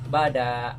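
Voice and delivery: slow "bada" with the "da" held long; correct reading. Shown as a demonstration. Teacher drilling students in the long "da" of "bada". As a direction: stretch the "da" in "bada" slowly. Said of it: "begitu, ya".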